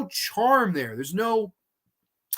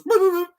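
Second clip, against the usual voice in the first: mock jump scare; 13 semitones higher, 5 dB louder, faster than usual. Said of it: "and goes".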